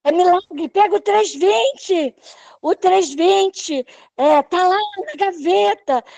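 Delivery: doctor yelling for the stent, high and excited; retold excited shout. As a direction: shout it high like a doctor who sees a miracle. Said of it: "E ele gritava".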